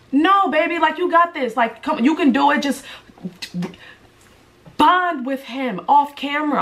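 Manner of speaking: encouraging. Retold or imitated. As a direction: hype her up gently. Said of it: "and I'd be like".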